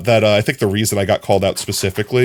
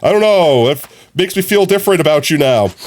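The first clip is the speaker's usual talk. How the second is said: high, louder startled reaction; mock surprise; 9 semitones higher, 4 dB louder than usual. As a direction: react taken aback by someone's voice. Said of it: "surprised people when they're like".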